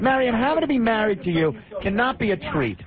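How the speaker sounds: high, heated insult at the caller; shouting insult. Real, not acted. Host yells insults at caller.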